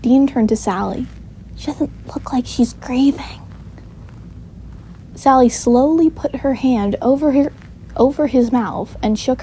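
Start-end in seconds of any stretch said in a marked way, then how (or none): 1.5-3.4 put-on soft, half-whispered child's remark; hushed aside. A narrator's reading. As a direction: say it under your breath. Child's aside about grieving mother.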